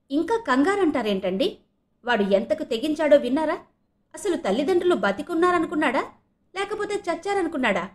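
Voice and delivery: high, climbing string of outraged questions; indignant anger. A narrator's reading. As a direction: demand answers from husband, pitch mounting. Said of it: "కోపం ఏమాత్రం తగ్గలేదు". (కోపంగా గొంతు పెంచి నిలదీస్తూ)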